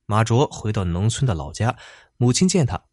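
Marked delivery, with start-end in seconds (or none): none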